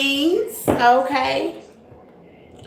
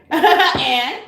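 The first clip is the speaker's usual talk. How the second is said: laughing; 5 semitones higher, much slower than usual. Real, not acted. Laughing while repeating neighbor.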